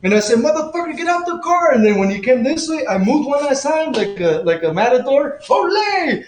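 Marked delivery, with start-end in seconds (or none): 0.4-1.7 high-pitched challenge called at the driver; retold angry yell. To the driver who tried to hit him, high angry challenge. 5.5-6.2 high playful Ole as he sidesteps; mock matador cry. Dodging the car like a matador, high mock cry.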